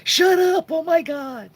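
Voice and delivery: very high, loud shriek of embarrassment; mortified shriek. Retold or imitated. Teased girl shrieks at her cousin in front of the boy, high and loud.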